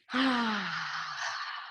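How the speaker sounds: long, soft falling sigh; long exhale. Real, not acted. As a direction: breathe out slowly on "ah". Exhales during exercise.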